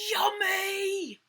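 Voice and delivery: high, loud, drawn-out squeal; children crying out. A narrator's reading. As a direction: squeal it like a class given treats. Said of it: "They all cried out".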